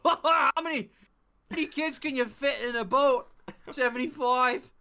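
loud, very high manic shout; screaming at people. Acted. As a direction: yell nonsense across the room, high and wild. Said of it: "Just screaming at 'em".

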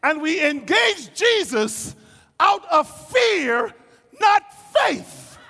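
loud, high, impassioned sermon shout; fiery preacher's holler; full-force preaching. Real, not acted. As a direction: drive it home to the congregation at full volume.

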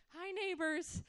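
mock-cheery call to neighbours; high-pitched greeting tossed to the neighbours. Carrying a screaming child past neighbours, calling out.

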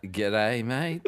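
soft, tentative, rising "g'day, mate"; unsure, half-questioning greeting back; hesitant reply. Retold or imitated. Australian answering over-excited Americans, hesitant.

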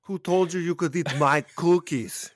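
high, loud action-star impression; hammed-up shouted movie outburst; Arnold. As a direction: roar it as an outraged movie impersonation. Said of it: "a scene in any Arnold movie".

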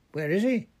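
high, loud demand; yelling. A narrator's reading. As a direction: yell it sharply upward as a question. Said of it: "yelled Fudge".

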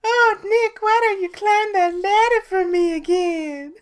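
high falsetto imitation; Tori. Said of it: "Tori does yell at me".

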